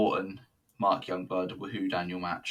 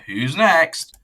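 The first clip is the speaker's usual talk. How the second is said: high, loud shouted lead-in; punchy, raised-voice cue for the next act; hyped call-out; 9 semitones higher, 12 dB louder than usual. Real, not acted. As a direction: belt it out like a ring announcer. Hyped host moving to next promo.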